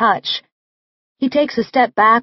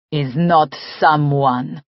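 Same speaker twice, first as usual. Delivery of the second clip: put-on slow, lower, heavy Russian accent, agitated; thick Russian accent; 5 semitones lower, slower than usual. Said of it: "his accent grows thicker".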